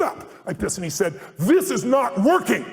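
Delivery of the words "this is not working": raised, slow, loud declaration; Reagan breaking off. Retold or imitated. Reagan standing up to end the lecturing, loud slow declaration. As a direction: stop the fight cold, loud and slow.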